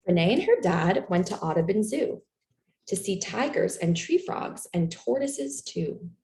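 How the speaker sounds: usual speaking voice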